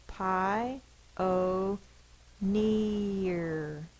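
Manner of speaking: "Pi", "o", "near" said as three separate syllables; syllables separated. Shown as a demonstration. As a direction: pause between "Pi", "o", "near" so each vowel is heard. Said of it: "Listen to the vowel sounds".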